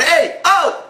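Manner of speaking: high, rising mock shouts; hollering from the car; pretend hollering. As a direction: whoop at the passing guy. Beat the horn.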